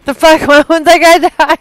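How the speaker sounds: loud, laughing disbelief; loud disbelieving gamer outburst; loud frustrated outburst. Real, not acted. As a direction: yell it in disbelief that he won't die. Frustrated outburst at surviving enemy.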